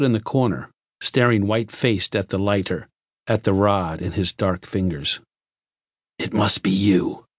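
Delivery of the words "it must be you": lightly grated accusation. A narrator's reading. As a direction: accuse with a slight rasp. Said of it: "the leiter grated".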